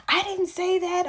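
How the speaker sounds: high-pitched imitation of an indignant caller; indignant denial. Patient denying what the receptionist heard, indignant.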